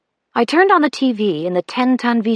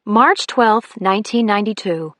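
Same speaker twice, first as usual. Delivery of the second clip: plain reading voice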